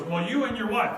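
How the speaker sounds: high, loud imitated girl's reply; teenage girl. High school girl answering a puzzled dad, imitated girl's voice. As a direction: imitate a girl stating the obvious.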